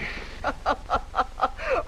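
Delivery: breathy rising scornful giggle; mocking laugh. Kitty laughing in the suitor's face, breathy scornful giggle. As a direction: laugh at him, no voice.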